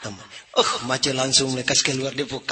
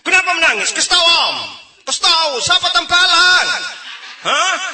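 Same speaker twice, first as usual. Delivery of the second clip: much higher, louder shouting uncle; mock shouted question; 7 dB louder than usual. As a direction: bellow at the crying child. Uncle yelling at crying kid.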